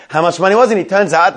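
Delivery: ordinary talk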